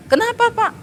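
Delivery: high, sweetly innocent kenapa; innocent query. Retold or imitated. Pastor retells answering angry husband. (polos dan tinggi)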